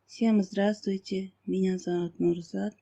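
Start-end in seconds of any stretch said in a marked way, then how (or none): none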